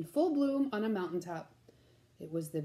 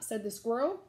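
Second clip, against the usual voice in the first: usual speaking voice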